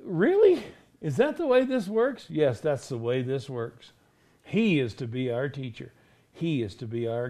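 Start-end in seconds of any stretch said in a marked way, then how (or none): 0.1-2.3 high-pitched, rising mock disbelief; incredulous question. Doubting listener questioning the teaching, incredulous.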